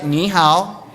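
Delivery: "Ni hao" with Mandarin tones, "ni" dipping then rising; "Ni hao" shown carefully in Mandarin tones. Shown as a demonstration. Speaker leading the audience in saying "Ni hao", with Mandarin tones. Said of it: "Just turn to them and say".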